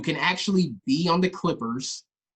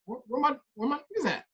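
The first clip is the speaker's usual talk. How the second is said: mock lost call; 9 semitones higher, 4 dB quieter, faster than usual. Imitating the abandoned trash talker.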